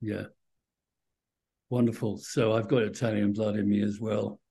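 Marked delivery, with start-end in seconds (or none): none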